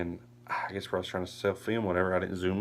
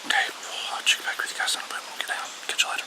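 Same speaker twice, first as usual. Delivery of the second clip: whispering; 3 dB louder, faster than usual. Real, not acted. Whispering in the hunting stand.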